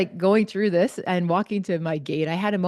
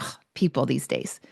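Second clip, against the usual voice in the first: imitating a huffed-off stranger, softer; mock huff, lower and under the breath; huffy grumble; 5 semitones lower, 4 dB quieter than usual. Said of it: "like, huffed off and he was just like".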